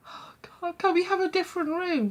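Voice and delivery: high, pleading mum voice, breathy start. Retold or imitated. Mum begging the landlady for another room, high and pleading.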